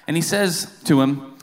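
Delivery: ordinary delivery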